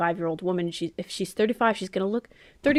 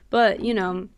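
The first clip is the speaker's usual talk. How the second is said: usual speaking voice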